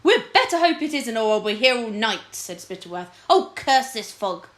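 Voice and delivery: a cross lord slogging through the marsh; irritated lord. Acted. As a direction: grumble it out sharply.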